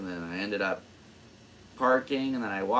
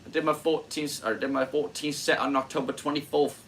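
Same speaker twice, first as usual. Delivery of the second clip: higher, mock British accent on "did my fourteenth set"; 6 semitones higher than usual. Comedian repeating his notebook line in a British accent. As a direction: slip into a playful British accent. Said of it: "I don't know why I'm British".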